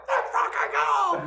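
very high, loud, squealed cheer; Carl. Retold or imitated. Carl echoes the hockey cheer, very high squealing yell. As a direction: echo the yell in an exaggerated squeal. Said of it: "And Carl's like".

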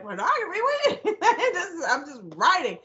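high-pitched laughing disbelief; shocked disbelief. Retold or imitated. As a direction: squeak it out in amused shock.

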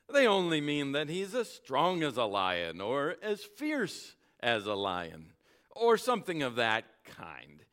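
loud, lofty know-it-all tone; pompous horse Bree. Acted. The proud horse Bree talking down to Aravis, pompous. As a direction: lecture the girl grandly.

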